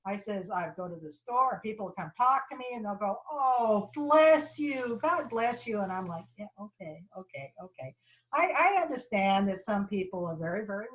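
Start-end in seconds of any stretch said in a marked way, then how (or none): 3.3-5.8 mock-pious gushing, higher and louder; effusive blessing. Imitates pious strangers in store. 6.4-7.9 humoring them. Retold or imitated. Humoring the pious strangers.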